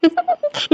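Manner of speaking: a short bashful giggle, high and soft; shy laugh. Acted. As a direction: giggle shyly at the compliment. Shy wife laughs at coffee praise.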